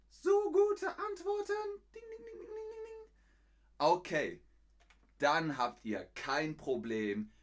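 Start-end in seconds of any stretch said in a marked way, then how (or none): 0.1-1.5 very high, loud mock-operatic delight; delighted praise. Teacher cheering the viewers' answers, high exaggerated delight. 1.9-3.1 very high, soft ringing dings; imitated bell. Teacher rewarding good answers, high imitated bell.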